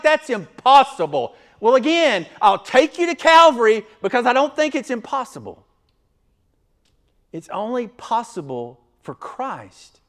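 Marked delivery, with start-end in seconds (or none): 0.0-1.3 loud, high cry of disbelief; incredulous objection. Acted. Acted objection from the listeners. 1.6-5.6 loud, high, fast preacher's rebuttal; full-force preaching. Real, not acted.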